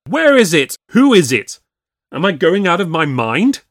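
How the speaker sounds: high, loud frantic questions; losing his nerve out loud; panicked outburst. A narrator's reading. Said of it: "broke out the lawyer".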